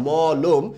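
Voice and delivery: মলম as a drawn-out maw, then lom; model pronunciation. Shown as a demonstration. Teacher answering their own question, model pronunciation. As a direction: say মলম in two syllables with a closed o in the second. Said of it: "কি হয় বলুন তো?".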